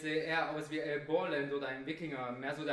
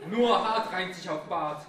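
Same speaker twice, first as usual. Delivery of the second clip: macho punchline; 2 semitones higher, 8 dB louder, slower than usual. Acted.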